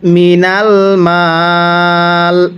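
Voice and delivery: মিনাল মাল with long মি and the final ল stopped with no vowel; pause form. Shown as a demonstration. Teacher shows stopping on মাল, slow recitation. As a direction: stretch মি, close on a bare ল. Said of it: "কিভাবে পড়ব?".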